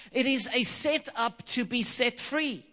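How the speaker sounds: ordinary delivery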